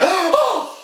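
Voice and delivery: very high gasping prissy squeal; shocked religious girl. Retold or imitated. Religious girl on the couch scandalized by the barber, high shocked gasps. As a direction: clutch your pearls, scandalized and breathless. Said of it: "the religious one's like".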